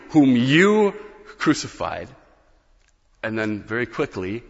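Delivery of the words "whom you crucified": "you" capitalized, higher and louder; raised, emphatic "you" with "crucified" dropping away; stress on you. Shown as a demonstration. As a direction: punch "you" in "whom you crucified". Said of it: "like if you and I capitalize the word "you".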